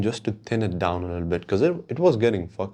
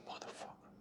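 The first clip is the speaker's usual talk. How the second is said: barely audible, breath-only swearing; whispered curse; 29 dB quieter, faster than usual. Retold or imitated. Man reacting to his too-short haircut, whispered curse. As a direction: swear under your breath in disbelief.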